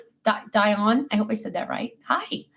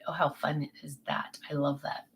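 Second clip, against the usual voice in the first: lower, soft unvoiced whisper; hushed delight at a viewer's love story; 9 semitones lower, 11 dB quieter than usual. Real, not acted. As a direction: breathe it out like a secret. Reacting to blind date story.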